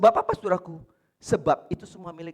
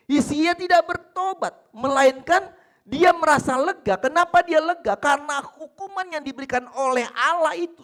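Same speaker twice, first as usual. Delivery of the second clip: loud, raised preaching; forceful preaching; 10 semitones higher, 8 dB louder than usual. Acted. Pastor preaching loudly about Hezekiah. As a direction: press the warning home.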